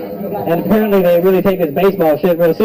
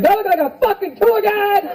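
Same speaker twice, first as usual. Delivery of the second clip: mock New Yorker snapping; 11 semitones higher than usual. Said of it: "He's like".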